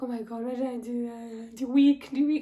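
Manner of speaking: high, loud, mortified cry; panicked self-talk. Retold or imitated. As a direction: blurt it in embarrassed panic. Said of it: "I was like".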